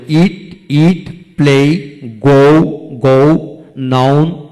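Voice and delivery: ইট, play, গো each one long slow beat; one-beat word drill. Shown as a demonstration. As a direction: stretch each word from ইট to noun, pause after each. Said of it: "monosyllable বুঝে নেব".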